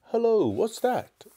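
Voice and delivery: surprised. A narrator's reading. Boy spots something in grass.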